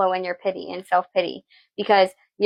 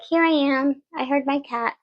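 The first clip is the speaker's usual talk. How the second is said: higher, playful cat voice; voicing the cat; 6 semitones higher than usual. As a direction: speak for the cat as it arrives. Voices her cat arriving.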